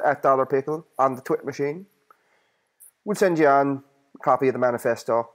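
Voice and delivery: ordinary delivery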